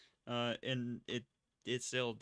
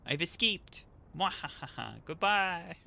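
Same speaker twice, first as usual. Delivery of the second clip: mad scientist; 6 semitones higher, 5 dB louder, slower than usual. Acted. Gloating villain after escaping.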